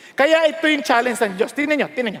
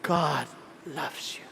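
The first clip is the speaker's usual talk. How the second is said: much lower, soft breathy near-whisper; a handsome pastor charming the women; smooth preacher voice; 12 semitones lower, 13 dB quieter, much slower than usual. Retold or imitated. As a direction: croon it like a dreamy handsome preacher. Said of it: "pag preach".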